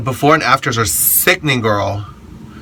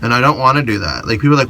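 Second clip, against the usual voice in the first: ordinary talk, nothing marked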